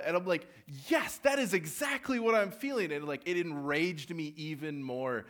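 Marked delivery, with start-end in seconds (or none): 0.9-2.9 much higher and louder, mock agreement; exasperated sarcasm. Camper mocking the guide's remark, high and loud. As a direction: throw it back sarcastically. Mocking her own inner reaction.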